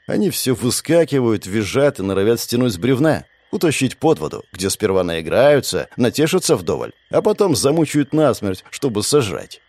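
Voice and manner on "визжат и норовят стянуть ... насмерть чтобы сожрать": ordinary delivery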